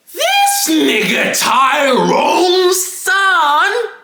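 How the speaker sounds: high, long-drawn wail on every word; exasperated yell. Real, not acted. Ranting to viewers about classmate Tyrone, exasperated yell. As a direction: stretch it out in a high, incredulous cry.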